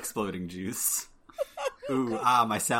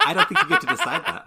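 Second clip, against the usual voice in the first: much higher, loud, laughing; laughing tease; 8 dB louder, faster than usual. Real, not acted. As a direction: squeal the words while laughing.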